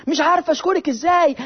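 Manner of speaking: high-pitched imitation of a thankful woman; grateful mother. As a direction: gush thanks like a commercial actress, lightly voiced.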